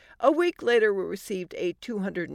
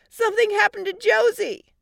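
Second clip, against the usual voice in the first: put-on high, loud alarmed kid; child's scream; 13 semitones higher, 7 dB louder than usual. Four-year-old alerting parents about sister, screaming. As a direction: shout it urgently in a little kid's voice. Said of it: "our four-year-old screamed".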